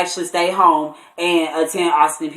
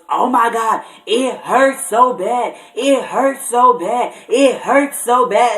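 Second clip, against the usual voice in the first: higher, slow drawn-out moaning of pain; imitating panicked pain; 5 semitones higher, slower than usual. Sister on the phone in labor pain, imitated moan. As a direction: act out the sister's frantic hurting. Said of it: "frantically freaking out".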